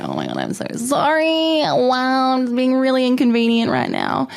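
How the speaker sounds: high, drawn-out "so sorry"; squeaky-high, over-apologetic retelling; mock apology. Apologising to the drive-thru queue for stalling, high-pitched mock apology.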